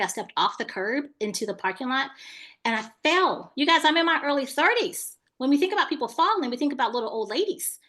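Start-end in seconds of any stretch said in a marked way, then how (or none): none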